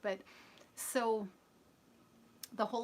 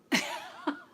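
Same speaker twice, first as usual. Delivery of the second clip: sudden breathy burst of laughter; real laugh bursting out; 7 dB louder than usual. Real, not acted. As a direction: crack up out of nowhere.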